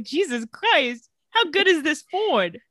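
slow Jesus Christ, then a high, put-on voice on the Ford; hammed-up awe at a car; mock amazement. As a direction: gush over the car in a sarcastic ad voice. [name] mock-praises the Ford.

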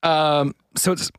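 throat catching, clearing it mid-word; croaky voice, stuck on a frog in the throat. Real, not acted. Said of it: "sorry, I got a frog in my throat".

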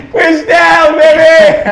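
very high, loud whoop of words; exuberant shout. Real, not acted. Host celebrates with the room, shouting exuberantly. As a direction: holler it out between laughs.

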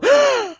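sharp high gasp; mock shocked gasp. Mocking the reaction to a bald person, gasp. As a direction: gasp in hammed-up alarm.

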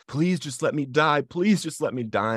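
high, pleading cry to God; screaming at God. Retold or imitated. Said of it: "I'm screaming at him".

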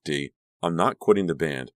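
usual speaking voice

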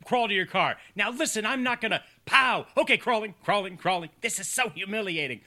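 high, whiny comic protest; imitating a man forced to crawl; mock humiliated man.

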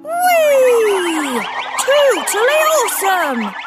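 high, loud thrilled cheer; excited whee. Acted. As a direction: squeal with delight on the spin. Thomas thrilled on turntable.

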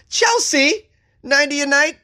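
high, loud disbelief; shocked at the price; incredulous. Real, not acted. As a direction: squeak out the disbelief. Mock disbelief at cheap price.

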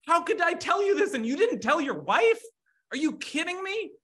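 high-pitched, incredulous scolding; mad God. Acted. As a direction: act out God's exasperation with a husband. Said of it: "God's mad at Abraham".

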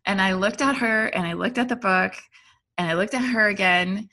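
usual speaking voice

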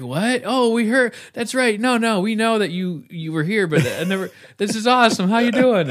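put-on high, loud retelling of thrilled kids; mock excited kids. Voices startled kids answering door.

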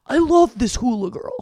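mock dad. Voicing dad protesting.

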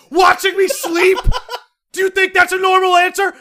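high shouting disbelief with a laugh; student yelling back at a creepy roommate; incredulous yelling. Retold or imitated. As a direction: yell it in outrage. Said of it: "I'm, like, screaming at him".

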